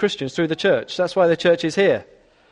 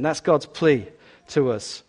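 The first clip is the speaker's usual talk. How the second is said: ordinary talk, nothing marked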